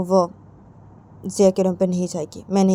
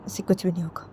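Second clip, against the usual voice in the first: whispered refusal; 3 semitones lower, 7 dB quieter than usual. Retold or imitated. Ritu refusing her mother.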